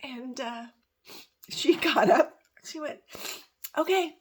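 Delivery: high voice, close to tears; touched, emotional. Real, not acted. Telling how her friend bawled in her lap, choked up. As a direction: tell it welling up, a laugh breaking through. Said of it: "That really touches me".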